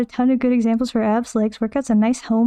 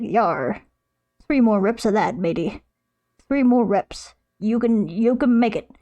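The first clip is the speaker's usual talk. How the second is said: pirate voice; slower than usual. Pretend. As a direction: growl it like a peg-leg pirate. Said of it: "what freaking pirate voice I'm doing".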